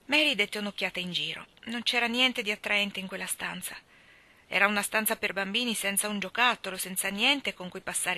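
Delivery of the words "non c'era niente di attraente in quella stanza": ordinary delivery, nothing marked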